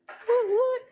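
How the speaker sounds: playful cheer. Real, not acted. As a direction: celebrate a small win. Cheering her own purchase.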